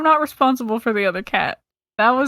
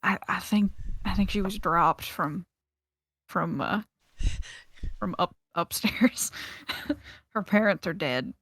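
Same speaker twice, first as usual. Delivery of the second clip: hushed, low, halting confidence; lowered voice; 4 semitones lower, 9 dB quieter than usual. Acted. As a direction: sidle up and break it quietly. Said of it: "lower my voice and be like".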